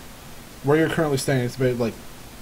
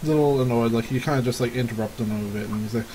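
usual speaking voice

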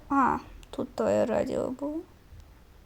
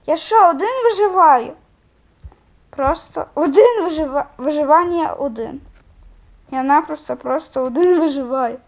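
exasperated complaint; 2 semitones higher, 7 dB louder than usual. Real, not acted. Gamer complaining loudly, alone.

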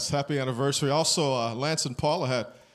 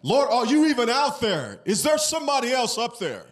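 higher, pleading questions to heaven; anguished questioning of God; 6 semitones higher than usual. Pretend. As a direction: cry out the doubts.

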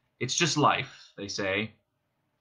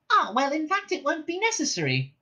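high little mouse voice. Acted. Frankie Mouse tells the old man, in mouse voice. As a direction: pipe it in a high creature voice.

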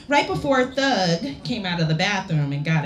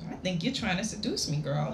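hushed, teasing man's voice; soft seductive murmur; 7 dB quieter than usual. Acted. Man's low seductive line.

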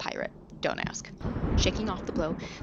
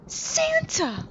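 very high excited squeal; delighted squeal; 7 dB louder than usual. Acted. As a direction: squeal with joy. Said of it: "Miguel squealed".

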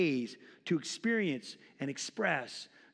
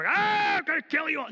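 very high, loud crazed scream; hammed-up berserk war cry; mock battle yell; 11 semitones higher, 7 dB louder than usual. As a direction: charge in screaming like a wild gunman. Acting a crazed armed defender.

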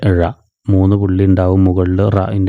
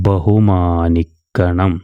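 slow joined reading; much slower than usual. Shown as a demonstration. Teacher answers her own question slowly for pupils. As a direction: draw the joined word out slowly. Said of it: "ചേർത്ത് വായിക്കുമ്പോൾ എങ്ങനെയാവും?".

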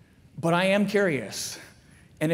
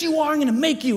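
mock insistent mom; 8 semitones higher, faster than usual. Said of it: "I was in a big fight with my mom".

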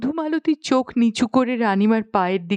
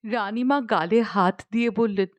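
plain reading voice

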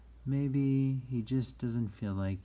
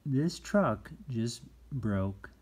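ordinary talk

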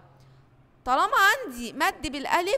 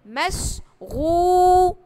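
مشغول with the oo held long and louder; drawn-out long vowel; 4 semitones higher, 9 dB louder, much slower than usual. Shown as a demonstration. Teacher stretching the vowel for the class, slow and loud. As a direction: draw out the oo in مشغول. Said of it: "زي كلمة ايه؟".

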